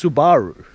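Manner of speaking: "Subaru" with the stress on SU; correct pronunciation. Shown as a demonstration. Australian conceding the proper brand name, "Subaru" as SU-ba-ru. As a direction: say "Subaru" as SU-ba-ru, first syllable strongest. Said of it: "we mispronounce things".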